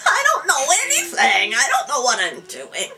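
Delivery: high, loud mock wail, easing toward the end; flustered protest. Untrained student answering the scolding teacher, in flustered protest. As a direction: protest helplessly, hammed-up.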